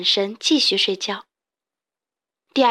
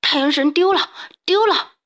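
rising high, loud dream cry; distressed sleep-talk; 6 semitones higher, 4 dB louder than usual. A narrator's reading. Xiaopangdun talks in his sleep, distressed. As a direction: cry out from a nightmare. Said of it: "说着梦话".

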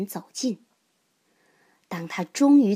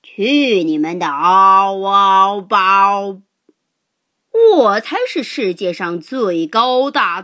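loud, slow booming giant's shout; 7 dB louder than usual. A narrator's reading. Giant shouting down at the three animals, loud and slow. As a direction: boom it down from above. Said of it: "巨人凌空对他们三个喊道".

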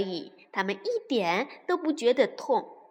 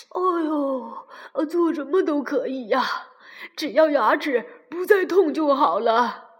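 moaning fox voice, growing louder; groaning fox; 6 dB louder than usual. A narrator's reading. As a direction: groan the words through the toothache. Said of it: "狐狸呻吟着说".

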